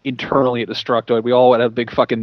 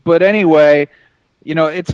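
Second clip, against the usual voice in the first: ordinary delivery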